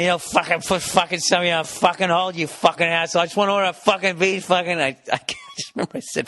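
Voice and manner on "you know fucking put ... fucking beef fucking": mock furious rant, higher and fast; hammed-up angry caller swearing; ranting F-bombs. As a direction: unload on the phone guy. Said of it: "I just start dropping F-bombs".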